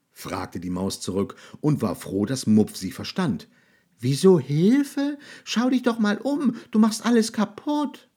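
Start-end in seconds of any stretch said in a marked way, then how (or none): 4.0-8.1 high, loud squeaky voice; mouse scolding. A narrator's reading. Mouse scolds the monster for wrecking everything, high and loud. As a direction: tell the monster off like a tiny mouse.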